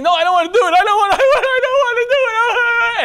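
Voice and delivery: high whiny cry breaking into laughter; man pretending the yeerk forces him, laughing and wailing high; mock anguished protest. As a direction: ham up a helpless wail.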